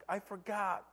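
high, soft imitation of crying; retold child's whimper; tearful child. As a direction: confess like a small boy in tears. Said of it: "he started crying".

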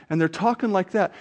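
ordinary talk

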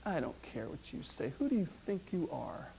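preacher voicing a sinner's hushed defiance; mock defiance. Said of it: "looking up into heaven going".

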